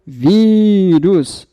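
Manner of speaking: "Vírus" with a long-held first syllable; stress on first syllable. Shown as a demonstration. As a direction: stretch the VÍ of "Vírus" to show the stress. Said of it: "a sílaba tônica é o vi". ("Vírus" com o "ví" tônico bem alongado)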